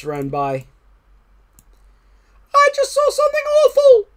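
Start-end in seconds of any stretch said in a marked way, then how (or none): 2.5-4.1 tiny mouse squeal, very high, loud and fast; panicked mouse cry. A narrator's reading. Mouse warning Frog of something awful, squealing in panic. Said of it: "cried the mouse".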